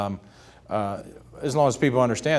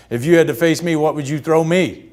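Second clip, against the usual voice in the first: loud, raised, challenging boom; big booming voice; 5 semitones higher, 8 dB louder than usual. Retold or imitated. Ted Williams challenging the young pitcher, booming. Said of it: "I hear a big boom of voice".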